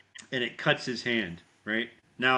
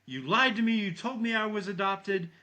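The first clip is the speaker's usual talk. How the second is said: high-pitched mock outrage; worked-up imitation of a betrayed boy; retold fury; 7 semitones higher than usual. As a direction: act out a furious kid's outburst. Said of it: "He gets pissed".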